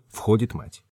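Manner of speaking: plain reading voice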